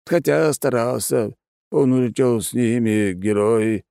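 put-on slow, high, mumbling old man; dignified old man. A narrator's reading. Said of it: "с достоинством прошамкал ещё ранее летавший старик".

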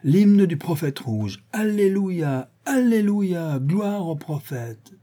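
high, drawn-out alleluias; hymne proclamé. Acted. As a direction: chant out a grand hymn of praise. Proclame un hymne, alléluias lancés. (hymne solennel, aigu et traînant)

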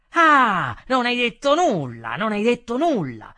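loud, high-pitched shouting; angry retort. A narrator's reading. Customer mocking the waiter's denial, shouting angrily. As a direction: throw the words back, shouting.

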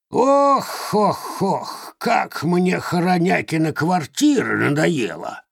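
high, sighing crone; complaining hag groaning. Acted. (стонущая старая ведьма)

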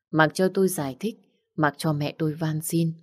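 ordinary delivery, nothing marked

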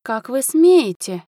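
high, slow, affronted outrage; indignant exclamation. A narrator's reading. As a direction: draw it out like an offended countess. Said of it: "воскликнула графиня, округлив глаза".

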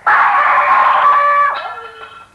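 big shrieking laugh, high and loud; loud high cackle. Acted. Detective laughing loudly at his own joke, high cackle.

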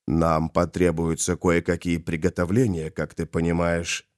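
low, soft villain voice; hushed, composed low speech; scheming Molokh. Acted. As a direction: explain quietly, pitched well down. Molokh begins planning.

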